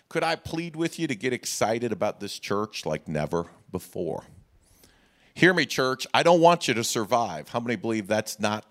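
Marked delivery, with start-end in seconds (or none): none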